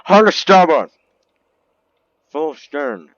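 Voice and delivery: high, loud captain's shout; captain yelling orders. Acted. Captain yelling to crew as tsunami comes. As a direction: bellow the order to turn hard. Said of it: "he yelled".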